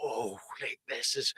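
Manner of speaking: soft, awed hush; a dazed clubber remembering first hearing the tune, quiet and awed. Retold or imitated. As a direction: breathe it out in quiet wonder. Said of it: "I remember just standing there going".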